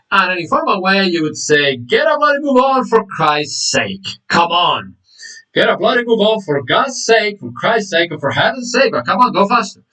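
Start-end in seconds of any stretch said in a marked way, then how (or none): 1.8-9.8 hammed-up impatient outburst; angry impatience. Shown as a demonstration. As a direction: hurry the driver along, fed up. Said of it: "unless you're extremely angry".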